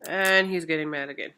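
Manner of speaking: ordinary talk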